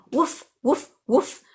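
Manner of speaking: light, playful woof woof woof; dog barking sound. Shown as a demonstration. Teacher showing students a dog's bark, playful. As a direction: bark like a friendly dog for the class. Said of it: "how does a dog sound?".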